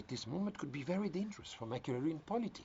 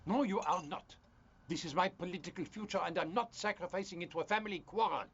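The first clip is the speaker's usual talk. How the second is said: angry refusal; 5 semitones higher than usual. Acted. Husband forbidding a call to the police, angry. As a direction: put your foot down angrily.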